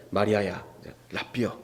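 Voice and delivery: quietly, as to someone dearly close; intimate. Retold or imitated. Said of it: "굉장히 이제 친밀하게".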